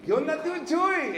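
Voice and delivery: high, loud cheerful greeting; voicing the kid greeting Tio Chuy. Nephew greeting Tio Chuy, high and cheerful. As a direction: call out to him like a happy nephew.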